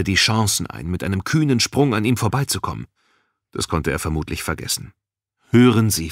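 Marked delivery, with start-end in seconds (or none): 5.5-6.1 insistent appeal. A narrator's reading. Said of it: "versuchte er es noch einmal".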